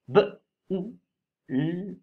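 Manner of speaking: "B-O-U-L-E" sounded out slowly as b, ou, l, e; sounded-out riddle. Shown as a demonstration. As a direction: give "B-O-U-L-E" sound by sound with gaps, as a clue. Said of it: "allez, je vous fais deviner".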